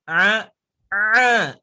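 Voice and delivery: loud ain comparison; ain contrast. Shown as a demonstration. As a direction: say A both ways for comparison. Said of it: "Enaknya yang mana".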